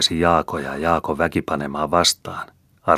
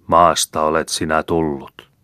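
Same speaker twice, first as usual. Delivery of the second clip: slow, louder priestly intoning; slow graveside liturgy; solemn burial words; 5 dB louder than usual. A narrator's reading. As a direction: intone the rite over the grave. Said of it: "heitti lapiollisen ja sanoi".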